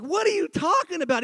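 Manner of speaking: high-pitched protest of disbelief; mock incredulous. Friends questioning the director's punchline, incredulous. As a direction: pitch it way up, baffled. Group objects to changed punchline.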